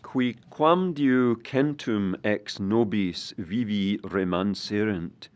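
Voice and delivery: loud, ringing formal recitation; Latin declamation. Acted. Poet opens his lecture to the audience, loud Latin declamation. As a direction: declaim the Latin loudly in measured phrases.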